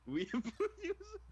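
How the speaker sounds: very high, baffled; surprised. Real, not acted. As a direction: ask in disbelief. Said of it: "He uses weeaboos?".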